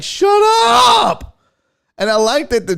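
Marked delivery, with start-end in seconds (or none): 0.0-1.4 loud, high imitation shriek; hammed-up drawn-out screech; mock yell. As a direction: yell it like the mocked Karen. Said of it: "She's like".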